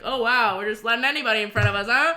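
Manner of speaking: loud, quick fake amazement; mock-casual complaint. Clubber griping about people butting in, loudly mock-casual. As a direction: toss it out loudly for the whole line to hear. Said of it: "casually being like".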